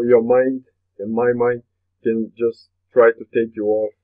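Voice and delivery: usual speaking voice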